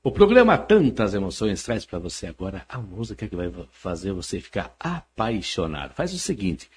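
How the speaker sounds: radio announcer. Shown as a demonstration. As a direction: announce the song like a late-night DJ. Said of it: "Faz uma chamadinha de música".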